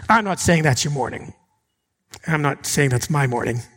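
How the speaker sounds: normal talking voice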